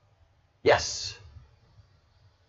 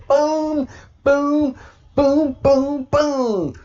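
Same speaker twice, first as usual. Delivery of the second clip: excited booms; 14 semitones higher, 6 dB louder, much slower than usual. Real, not acted. Gleeful reaction while reading hearing.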